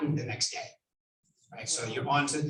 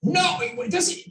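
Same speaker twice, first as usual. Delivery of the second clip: mock seller objection; 13 semitones higher, 4 dB louder, much slower than usual. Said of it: "what they say on the phone".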